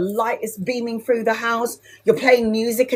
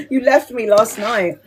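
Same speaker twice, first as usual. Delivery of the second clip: mum's sulky whine, a changed voice; teasing imitation of a guilt-tripping mum; whiny mum. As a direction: mimic a mother playing the victim. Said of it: "my mum was playing the victim".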